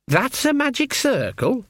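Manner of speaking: high, slow disbelieving question; a young girl who can't believe it; incredulous girl. A narrator's reading. Perdita doubting the circle.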